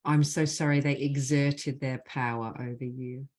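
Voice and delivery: measured, deliberate leading of a phrase; guided healing phrases. Acted. Facilitator gives the group apology phrases to repeat, measured and deliberate. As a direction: lead the group line by line, leaving space after each.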